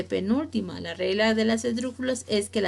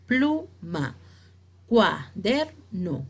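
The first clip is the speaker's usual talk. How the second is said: "Pluma", "cuaderno" slowly, syllable by syllable; split into syllables; much slower than usual. Shown as a demonstration. Teacher giving examples of division, "Pluma, cuaderno" in separate syllables. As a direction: leave gaps inside "Pluma" and "cuaderno". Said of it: "solamente estamos dividiendo la palabra".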